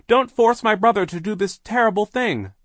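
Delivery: high, loud plea; crying out. A narrator's reading. Sister begs the hypnotist, crying out. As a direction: cry out to beg her. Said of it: "Violet cried".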